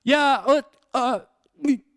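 high, choppy voice breaking up over the speaker; cutting-out intercom voice. Retold or imitated. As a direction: play a garbled drive-thru mic. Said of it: "just cutting out like crazy".

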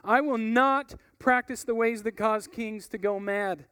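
loud, firm declaration; burn with conviction; David answering with fiery resolve; blazing with fire. Acted. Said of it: "David's answer that blazed with fire".

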